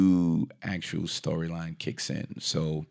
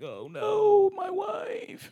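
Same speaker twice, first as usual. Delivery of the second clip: very high shouted wail; mock anguish; much slower than usual.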